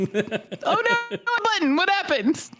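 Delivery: high startled confusion; surprised. Real, not acted. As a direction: caught off guard by the screen. Phone app glitch surprise.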